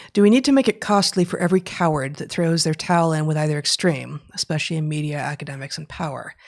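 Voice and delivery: loud, declaimed reading, easing towards the end; question read aloud. Real, not acted. Co-host reads out an audience question on cowardice, read-aloud voice. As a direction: read it out like a written proclamation.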